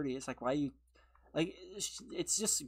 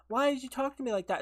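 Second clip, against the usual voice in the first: higher and quick, hurt protest; mock hurt demand, higher and fast; imitating upset girlfriend; 8 semitones higher, 4 dB louder, faster than usual. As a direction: fire it back like she's offended. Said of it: "she gets upset and she's like".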